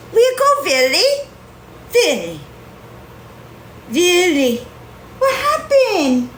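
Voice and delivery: high pet voice with a laugh. Real, not acted. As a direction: call the cat sweetly, giggling. Calling and cooing to cat.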